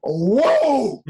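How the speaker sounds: sudden high, loud whoa; startled whoa. Retold or imitated. Retelling the botched drill to friends, a high startled whoa. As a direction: yelp it out like the move surprised you. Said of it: "So I go".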